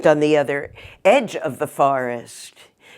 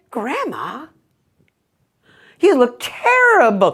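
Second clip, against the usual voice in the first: put-on higher little-girl voice, shocked; a worried little girl; higher girl's voice, taken aback; 7 semitones higher, 3 dB louder than usual.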